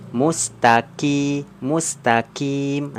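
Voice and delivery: mustaqim drawn out slowly, first with a light k; slow recitation demo. Shown as a demonstration. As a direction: say mustaqim slowly with the qof held back. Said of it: "Nah, ini hati-hati juga". (mustaqim dibaca pelan, kof dari pangkal lidah)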